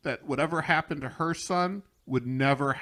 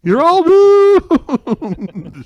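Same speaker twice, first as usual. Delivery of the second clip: very slow, much louder and higher doom drawl; ominous warning; 17 dB louder, much slower than usual. Retold or imitated. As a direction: stretch it out like a wailing prophet. Impression of Crazy Ralph's warning.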